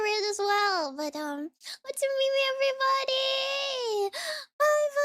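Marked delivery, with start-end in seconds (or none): 1.9-5.1 excited farewell. Real, not acted. Cheerful sign-off to chat.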